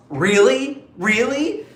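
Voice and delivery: "Really?" climbing high in disbelief; incredulous rising repeats. Retold or imitated. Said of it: "Balint was like".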